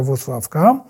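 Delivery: usual speaking voice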